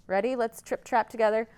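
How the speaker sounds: ordinary delivery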